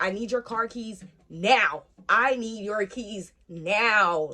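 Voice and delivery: slow, forceful, with NOW stretched and loud; urgent demand. Retold or imitated. Patting mom for car keys, slow insistent demand with "now" drawn out. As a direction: spell out the demand, landing hard on "now".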